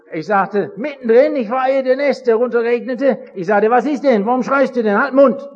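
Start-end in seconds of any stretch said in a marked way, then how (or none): 3.7-5.5 loud, high angry scolding, retold; angry father. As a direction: snap at the crying boy to shut up. Father scolds screaming son. (wütend laut schimpfender Vater)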